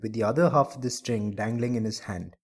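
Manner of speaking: ordinary delivery, nothing marked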